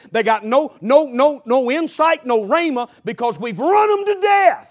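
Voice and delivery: rising to a shouted peak; preaching shout. Real, not acted. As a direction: build up to a high, loud preacher's shout.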